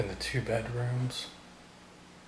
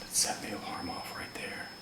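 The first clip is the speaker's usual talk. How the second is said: hushed whisper; 6 semitones lower, 12 dB quieter than usual. Real, not acted. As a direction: whisper it, unnerved. Whispered reaction to alarm.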